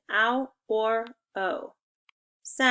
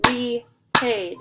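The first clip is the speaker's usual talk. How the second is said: "Repaid" louder, beat on each syllable; clapped syllables; 6 dB louder than usual. Shown as a demonstration. Teacher claps the word for the class, loud beats. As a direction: clap "Repaid" out in two beats. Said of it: "let's clap it out".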